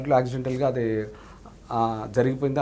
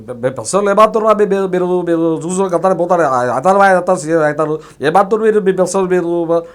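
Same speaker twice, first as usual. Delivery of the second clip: put-on louder, rolling, stammering voice of an old RTC chairman; Satyanarayana impression; 3 semitones higher, 8 dB louder than usual. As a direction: roll the words out loud with stuttered "బే-, బే-". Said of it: "ఈ కొంచెం ఇలా role అవుతూ ఉంటుంది ఆయన voice".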